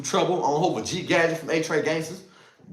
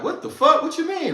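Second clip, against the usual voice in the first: pitched way up, disbelieving; shocked outburst; 12 semitones higher than usual. Retold or imitated. Retelling a jail fight, reacting to his boy's challenge, shocked and high. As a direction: shout it like you can't believe it. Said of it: "I'm like".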